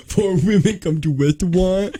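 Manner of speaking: laughing lisp, W for R; R sounds turned to W while laughing. Shown as a demonstration. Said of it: "Like you were talking to a child?".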